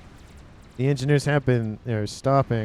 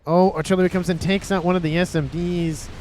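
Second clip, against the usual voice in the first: excited; 6 semitones higher, 5 dB louder than usual. Real, not acted. Caster to viewers, excitedly calling the artillery strike. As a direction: voice jumps up with sudden excitement.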